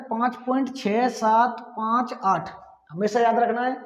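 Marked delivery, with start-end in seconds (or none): 0.0-2.5 slow, each digit after point said on its own. Shown as a demonstration. Teacher showing students the correct reading, slow and digit by digit. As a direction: space out each digit carefully. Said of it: "पढ़ा ऐसे जाता है".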